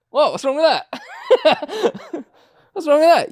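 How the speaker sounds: mock-innocent question broken by real laughter; cheeky laughing protest; laughing innocence. Real, not acted. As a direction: play it innocent while laughing. Laughing after cheeky joke.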